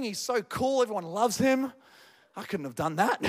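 normal talking voice

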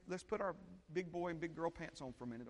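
ordinary delivery

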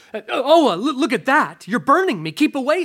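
high, loud panicked cry; frightened ghost. A narrator's reading. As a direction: recoil in alarm from burning hands. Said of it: "said the ghost, retreating".

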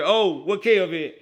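loud, high calling out. Real, not acted. Calling out to absent friend Kev.

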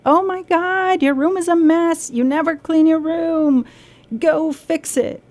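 scolding parent. Retold or imitated. Parent scolding child over messy room, exasperated and high. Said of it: "we come to the child and we say".

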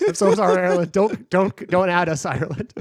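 playful panicked apology; laughing mock apology. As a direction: plead jokingly for forgiveness, half laughing. Mock apology to offended listeners.

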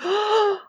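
mock horrified gasp; sharp, high gasp of retold shock. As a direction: gasp as if watching something awful. Retells shock while watching show.

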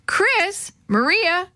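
high, drawn-out calling of names; parent's high-pitched call, not shouted. A narrator's reading. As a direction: summon the children from a distance. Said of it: "called Mister Vargas".

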